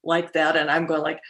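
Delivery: ordinary talk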